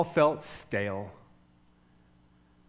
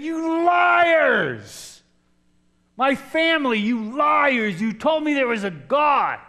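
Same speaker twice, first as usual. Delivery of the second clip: higher, louder furious cry of betrayal; angry shouting; 11 semitones higher, 7 dB louder, much slower than usual. Retold or imitated. Accusing his family of lying, a furious higher shout. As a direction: yell it at those who lied to you. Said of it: "I started shouting it".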